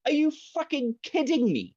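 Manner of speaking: high, disbelieving outburst; incredulous. Retold or imitated. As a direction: ask it in total disbelief. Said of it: "And I'm like".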